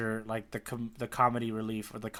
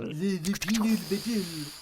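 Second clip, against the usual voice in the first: put-on vague French accent; 5 semitones higher, slower than usual. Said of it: "adopt accents that are vaguely French".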